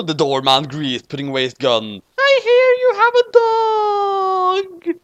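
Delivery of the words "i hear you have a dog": very high, slow crying whine; whiny falsetto. Acted. Doorman begging Light's mom for the dog.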